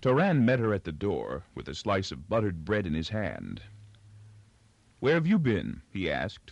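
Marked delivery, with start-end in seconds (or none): 5.0-5.8 high question through a mouthful; food-muffled. A narrator's reading. Husband greeting his wife at the door, mouth full. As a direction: ask it while still chewing. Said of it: "food muffled".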